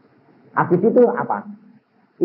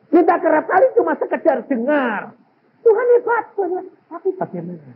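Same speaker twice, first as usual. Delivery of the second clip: raised, pitched-up sermon appeal; preaching; 10 semitones higher than usual. Acted. Preacher urging listeners to experience God, fervent preaching. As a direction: press the congregation with fervour.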